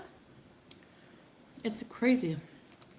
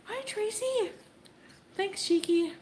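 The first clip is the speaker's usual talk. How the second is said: high chirpy greeting; 11 semitones higher, slower than usual. Real, not acted. Greeting viewers in chat.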